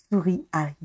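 usual speaking voice

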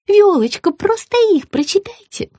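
high coaxing fluster; fussing. A narrator's reading. Margarita begs Viola to read it, fussing. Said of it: "засуетилась Маргарита".

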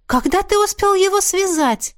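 high-pitched astonishment; astonished. A narrator's reading. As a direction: ask in open amazement. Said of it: "удивлённо воскликнула я".

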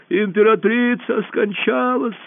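high, drawn-out announcement cry; crying out news. A narrator's reading. Someone telling the hall of a death, crying out.

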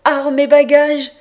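loud, high-pitched shout; full-voiced cry, pitched well up; shouted order. A narrator's reading. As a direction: bellow the release order down the corridor. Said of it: "On vient de me crier".

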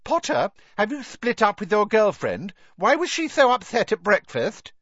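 high, loud mocking schoolgirl call; calling out taunt. A narrator's reading. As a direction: sing it out to needle him. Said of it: "Pansy called".

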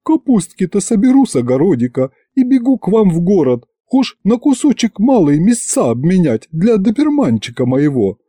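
high-pitched woe-is-me wheedling; plaintive tone. A narrator's reading. Aunt complaining to officials of her hungry life, plaintive. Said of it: "снова перешла на жалобный тон".

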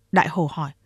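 plain reading voice

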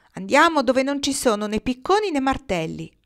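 mild, high shouted reply; calling back. A narrator's reading. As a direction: call the answer down to them. Nils calls back from the sky.

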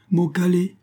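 high, eager cry; eager agreement. A narrator's reading. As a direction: jump at the bounty. Indian eager for bounty. (accord avide et aigu)

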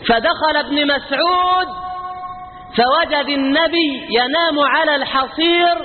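slow and high, stretching each word; slow, lifted retelling. Shown as a demonstration. Preacher retelling the scene slowly to the crowd, high and drawn out. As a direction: draw the words out for emphasis. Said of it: "repeats the line slowly, high-pitched".

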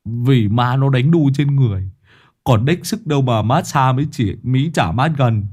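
annoyed retort. Acted. Lâm Sẹo glaring back at Đồng Già, irritated and sharp. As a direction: snap back at the coward. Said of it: "Lâm Sẹo trợn mắt".

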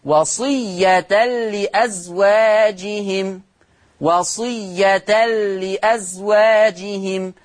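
very slow, drawn-out وصية as wasiyyatal-li before لأزواجهم; slow Hafs recitation, وصية ending in an "a" vowel; fatha with tanween. Shown as a demonstration. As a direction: stretch وصية لأزواجهم in recitation, "-tan" merging into the lam. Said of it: "يقرأ بفتح التاء".

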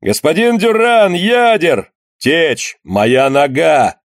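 high, drawn-out calls; urgent overlapping appeals. A narrator's reading. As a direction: cry out for help across the ship. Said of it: "повторяли разные торопливые голоса".

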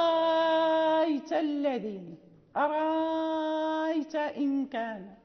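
"أرأيت" with the hamza turned to a long alif, drawn out; substitution with long madd. Shown as a demonstration. Teacher demonstrates Warsh substitution, long prolongation. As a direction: stretch the alif in "أرأيت" for six counts. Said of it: "إذا قرأنا بالإبدال".

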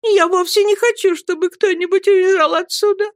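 high, tearful wife's voice; holding back tears. A narrator's reading. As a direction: plead in a high voice, swallowing tears. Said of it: "глотая слёзы, проговорила она". (высоким голосом, глотая слёзы)

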